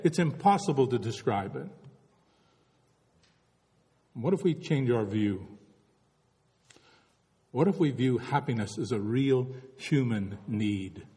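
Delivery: ordinary delivery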